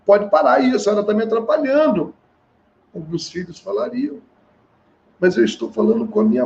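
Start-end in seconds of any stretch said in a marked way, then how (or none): 0.1-2.1 fast, higher, irritated snapping; impatient scolding. Retold or imitated. Impatient child snapping at a mother who tries to help. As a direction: rush her like an annoyed child. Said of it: "poderia muito bem falar assim com ela".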